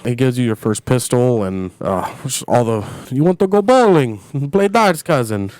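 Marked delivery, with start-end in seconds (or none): 3.1-5.5 lightly voiced Roman, raised pitch; a hint of an eager cousin; imitating Roman. Host recalling the game, mimicking Roman. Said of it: "all the little Jacob things".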